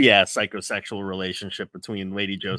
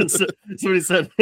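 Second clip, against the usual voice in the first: quick words breaking into laughter; laughing; 8 semitones higher, 4 dB louder, faster than usual. Real, not acted. Co-host trying to resume the quotes, laughing. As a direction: talk through a laugh, high-pitched. Speaker cracking up at co-host's joke.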